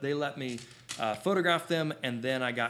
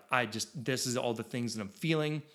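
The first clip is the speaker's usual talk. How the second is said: ordinary delivery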